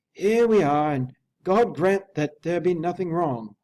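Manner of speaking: high, loud, strained butler; a harsh, cracking servant voice, loud and high; harsh, broken voice. A narrator's reading. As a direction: force the words out, harsh and broken. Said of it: "his voice harsh and broken".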